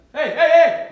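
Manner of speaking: loud high "Hey, hey, hey!" at running deer; yell. Retold or imitated. Hunter yelling at running deer to stop, full shout. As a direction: bellow it out high to stop them. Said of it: "yell at them".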